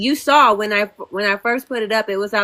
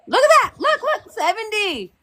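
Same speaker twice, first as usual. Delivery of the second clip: very high, squealing excitement; excited exclamation; 10 semitones higher than usual. Real, not acted. Panelist shows everyone the dropping number, excited squeal. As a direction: point it out in a high-pitched rush of glee. Watching follower count drop live.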